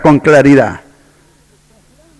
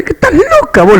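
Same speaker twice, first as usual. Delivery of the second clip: incredulous retort; 11 semitones higher than usual. Retold or imitated. Husband scoffs at wife's plea.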